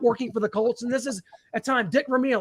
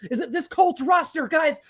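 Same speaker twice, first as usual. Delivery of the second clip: higher-pitched, fired-up sports rant; excited hype; 6 semitones higher than usual. Real, not acted. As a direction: sell the roster with rising excitement. Fan hyping up the Colts roster.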